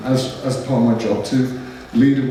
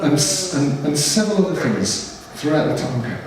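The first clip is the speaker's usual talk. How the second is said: usual speaking voice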